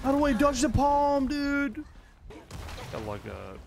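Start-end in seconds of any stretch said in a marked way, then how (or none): 0.0-1.8 loud, very high frustrated wail; exasperated yell. Real, not acted. Player asking how to dodge the palm, exasperated yell.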